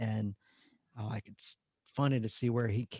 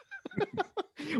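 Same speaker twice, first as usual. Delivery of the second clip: high laugh. Real, not acted. Laughs at own story.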